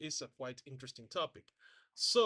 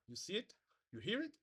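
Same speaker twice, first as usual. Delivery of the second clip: normal talking voice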